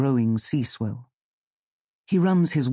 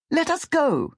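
high pleading call. Acted. Octopus begs fisherman to free them, high pleading. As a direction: plead in a piping creature voice.

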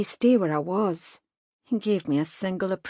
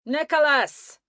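high, loud call from down the street; shouting a name across the street; distant shout; 8 semitones higher, 4 dB louder than usual. Acted. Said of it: "There was a shout".

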